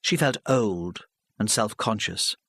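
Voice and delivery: ordinary delivery, nothing marked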